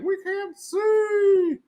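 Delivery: tiny, very high falsetto wail; drawn-out falsetto complaint; mock complaining characters. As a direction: squeak it slowly like helpless little creatures. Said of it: "they're like".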